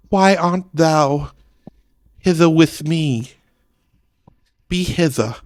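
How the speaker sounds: put-on high, loud and slow old stage English; Shakespearean English. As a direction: declaim it slowly like a Shakespeare actor. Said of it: "do they speak William Shakespeare?".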